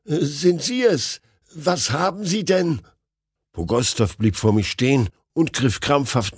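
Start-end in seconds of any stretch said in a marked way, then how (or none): 0.0-2.8 higher, slow worried question; alarmed. A narrator's reading. Narrator anxiously questioning his panicked friend. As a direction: ask in alarm.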